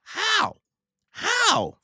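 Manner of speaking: very high, loud cry; disbelief. Real, not acted. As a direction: shout it in total amazement. Amazed at Luffy's feat.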